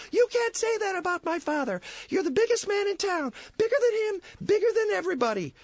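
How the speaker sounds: put-on very high, hurt kid voice; indignant boy. Young George stands up to Potter, high and indignant. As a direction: defend your father like an upset boy.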